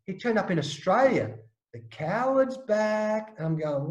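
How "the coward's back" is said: slow, drawn-out sneering taunt; mocking taunt. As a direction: stretch each word to needle him. Stalker's taunt repeated.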